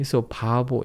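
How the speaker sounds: ordinary delivery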